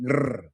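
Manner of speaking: long trilled R sound; demonstrating a Spanish R trill; rolled double R. Shown as a demonstration. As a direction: roll the tongue on a sustained rr. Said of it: "We roll or trill the R".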